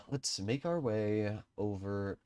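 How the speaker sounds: usual speaking voice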